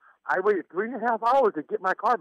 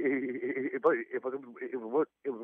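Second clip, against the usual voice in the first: stuttering on the first words; 5 semitones lower, 7 dB quieter than usual. Real, not acted. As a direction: stumble and repeat before the name. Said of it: "I stuttered a little bit when I talked".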